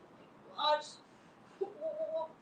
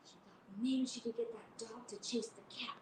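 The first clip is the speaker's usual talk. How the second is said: whispering; 2 semitones lower, 8 dB quieter than usual. A narrator's reading. Whispered scheming narration.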